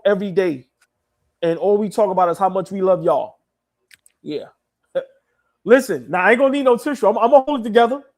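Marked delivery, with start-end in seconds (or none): none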